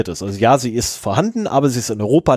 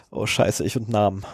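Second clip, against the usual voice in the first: cursing under breath; 4 semitones lower, 7 dB quieter than usual. Real, not acted. Fumbling a name mid-sentence.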